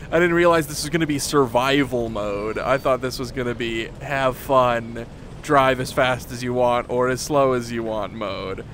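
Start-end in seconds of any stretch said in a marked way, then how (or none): none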